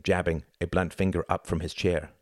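ordinary delivery, nothing marked

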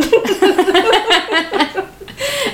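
high, loud burst of laughter. Real, not acted. Mother cracking up over her son's question, loud laugh. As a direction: burst out laughing at your own story.

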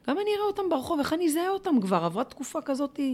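half-asking herself, lightly voiced; inner voice. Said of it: "הייתי אומרת לעצמי".

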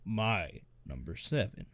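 slow, dramatic trailer announcer; movie trailer voice. Pretend. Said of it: "We'll start doing, like, trailer voices".

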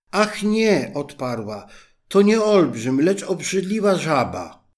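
high, loud, alarmed reply; frightened princess. A narrator's reading. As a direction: blurt it out in fright. Frightened princess answers king.

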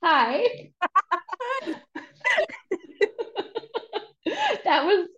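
giggling high through the words; laughing. Real, not acted. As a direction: laugh while you answer. Guest laughing at intro.

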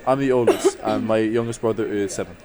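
usual speaking voice

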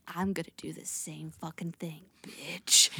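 whispered mock threat; menacing whisper. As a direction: hiss the threat right at him. Harriet threatening the man.

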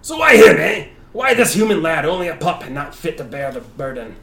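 high, loud, scornful shout; shouting warrior. Acted. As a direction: roar it at the boy.

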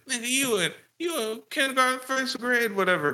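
high mock voice of a lecturing dad. Dad taunting his young son, high mock voice. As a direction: sneer it like the father. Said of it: "he's like".

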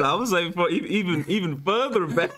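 laughing playful advice. Real, not acted. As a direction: joke through it with a grin.

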